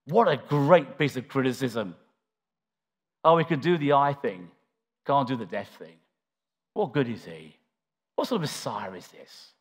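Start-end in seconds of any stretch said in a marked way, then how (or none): 3.2-9.5 sneering mockery. A mourner sneering at Jesus, scornful and low. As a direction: lower and softer, dripping with scorn. Preacher voices the sneering crowd.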